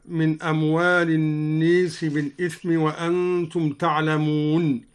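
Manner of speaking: "الناس" said an-nees, aa tilted to ee; imala on an-nas. Shown as a demonstration. Teacher shows the same imala, "الناس" as an-nees. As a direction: tilt the long vowel of "الناس" towards ee. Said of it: "وكذا أمال قوله تعالى".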